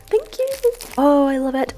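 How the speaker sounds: high, loud burst of thanks; excited thanks. Real, not acted. Thanking the giver for spooky gifts, loud and high. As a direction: squeal the thanks out.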